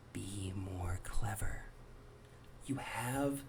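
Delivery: soft, slow, whispered advice. Real, not acted. Vlogger advising joke commenters, slow whisper. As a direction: lean in and whisper the advice slowly.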